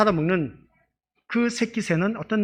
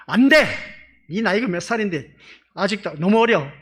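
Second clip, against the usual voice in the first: firm refusal; 3 semitones higher, 7 dB louder than usual. Retold or imitated. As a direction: shut the child down firmly. Parent refusing child's phone request. (단호하게 소리쳐 거절하는 엄마)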